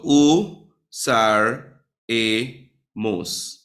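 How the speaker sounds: "u, sar, e, mos" in separate beats, stepping down in pitch; syllable by syllable. Shown as a demonstration. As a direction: break up "u, sar, e, mos" with a pause after each syllable. Breaking word into syllables to show stress.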